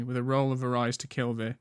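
ordinary delivery

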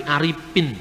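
"Aripin" said Sundanese style, F turned into P; Sundanese accent. Shown as a demonstration. Lecturer showing how Sundanese speakers say Arifin, Sundanese accent. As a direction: say "Aripin" with a P where the F goes, the way a Sundanese speaker would. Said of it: "Orang Sunda sulit untuk mengucapkan huruf F".

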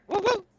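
high, celebratory woo-woo; high whoop. Real, not acted. Caller signing off with a cheer for Jersey, high whoop.

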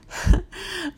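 high, loud burst of laughter; bright laugh. Real, not acted. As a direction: laugh at your own joke. Laughs at own pirate joke.

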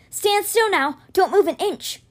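urgent order. A narrator's reading. Urgent order amid a fight.

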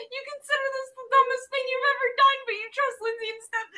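high, fast laughing narration. Real, not acted. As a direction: rattle it off through giggles.

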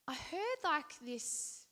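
normal talking voice

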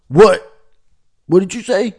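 loud, high-pitched challenge; mock incredulous outburst. Tyrone, who understands no Danish, challenges Munke Lars, loud and incredulous. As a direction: play up loud incomprehension.

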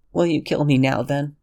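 high, small frightened plea; cowering Lexington. Acted. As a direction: shrink into a high whimpering excuse. Lexington resigned.

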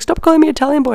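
mock complaint. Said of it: "he's like".

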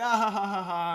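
loud dismissive laugh. Retold or imitated. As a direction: laugh off the invitation. Laughing off the tour offer.

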